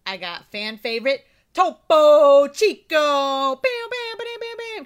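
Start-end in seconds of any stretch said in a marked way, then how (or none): 1.5-4.8 loud high hype fanfare; hype announcer. Pretend. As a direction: announce it like a big reveal. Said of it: "Can you hit the bam".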